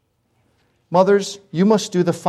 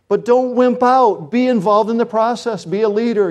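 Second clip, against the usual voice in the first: raised, higher voice, slowed on wimp out; rally the fathers, don't wimp out; preaching exhortation; 6 semitones higher, 4 dB louder than usual. Real, not acted. Pastor urging fathers in church, raised preaching voice.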